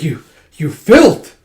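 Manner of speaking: slow, loud snarl; guard yelling at his attacker; furious guard. Acted. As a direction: spit out the insult slowly and loudly.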